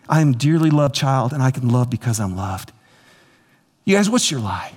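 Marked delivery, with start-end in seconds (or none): 0.1-2.7 low, settled recitation after the excitement; recited declaration. Real, not acted. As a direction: state it as a settled personal creed, dropping lower.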